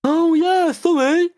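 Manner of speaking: high, loud mocking impression of the ticket woman; mock dismissive woman. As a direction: mimic her careless sorry, high and loud. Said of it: "And she's like".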